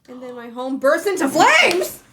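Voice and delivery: loud, high dramatic reading; dramatic shout. A narrator's reading. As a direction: belt the line out dramatically. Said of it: "why'd you read it like that?".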